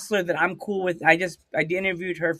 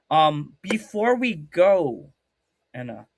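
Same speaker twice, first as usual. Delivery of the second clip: ordinary delivery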